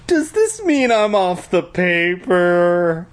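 high, slow, exaggerated meek whine. A narrator's reading. Boy asking about his place on the paper, hammed-up meek. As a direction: ask timidly in a high, drawn-out voice. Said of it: "I asked meekly".

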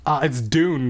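ordinary delivery

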